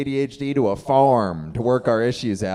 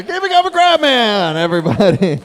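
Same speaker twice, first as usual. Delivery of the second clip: hype host; 10 semitones higher, 6 dB louder than usual. Real, not acted. Host hyping crowd for applause.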